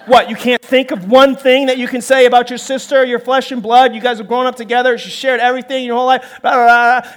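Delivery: loud, worked-up scolding; acted parental tirade running into blah-blah; exasperated parent rant. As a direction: scold the kids in disbelief.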